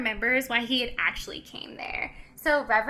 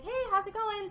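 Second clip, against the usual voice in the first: imitated friendly greeting; 9 semitones higher, 5 dB quieter than usual. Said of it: "goes right up to him and is like".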